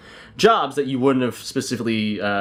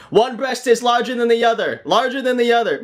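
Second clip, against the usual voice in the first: robot voice; 11 semitones higher than usual. Pretend. Store robot announcing the scan result to the shop, high and blaring.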